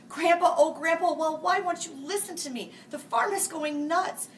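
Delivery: high, loud, exasperated call; child shouting in exasperation; frustrated shout. Acted. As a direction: yell at Grandpa who won't listen. Grandkids frustrated, calling Grandpa.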